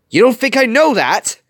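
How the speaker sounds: very high, loud outburst; angry snap. A narrator's reading. Wasabi fires back at Gogo, snapping angrily. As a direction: explode in indignant anger. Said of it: "Wasabi snapped".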